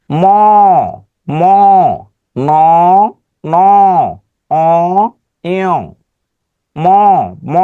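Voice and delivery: each letter ম and ন hummed through the nose; slow teacher's demo of nasal letters; nasal sounds demo. Shown as a demonstration. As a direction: let the air out through the nose on ম, ন. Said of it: "উচ্চারণের সময় বোঝা যাবে যে আমার সাথে নাক আছে".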